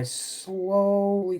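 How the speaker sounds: "slowly" with a long, held first vowel; drawn-out slowly. Retold or imitated. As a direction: stretch out "slowly" long and lazy. Stretches the word describing nodding off.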